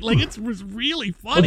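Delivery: usual speaking voice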